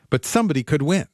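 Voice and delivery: usual speaking voice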